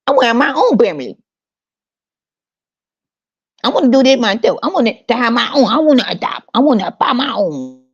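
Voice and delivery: high, fast put-on parent talk; mock dramatic parent. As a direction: mimic a whiny would-be parent. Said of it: "why the fuck are you talking like this".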